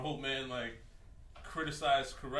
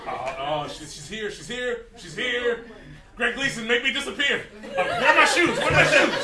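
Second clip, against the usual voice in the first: hammed-up frightened babble, getting louder and higher; mock panic; 6 semitones higher, 9 dB louder than usual. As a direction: pretend to panic as she closes in. Retold panic hiding from stalker.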